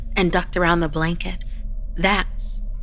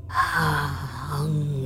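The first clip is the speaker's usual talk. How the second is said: slow, drawn-out croaking moan; 3 semitones lower, 4 dB quieter than usual. A narrator's reading. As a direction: croak it like a starving shadow creature. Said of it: "she moaned and croaked again".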